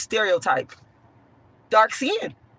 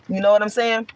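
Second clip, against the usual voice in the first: ordinary delivery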